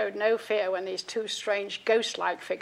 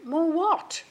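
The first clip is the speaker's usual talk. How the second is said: puzzled; 9 semitones higher, 5 dB louder than usual. Retold or imitated. Puzzled family member's reply.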